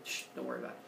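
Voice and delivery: low, soft shushing; calm them down, low and hushed; low hushed dismissive dad; hushing. Retold or imitated. Father hushing kids.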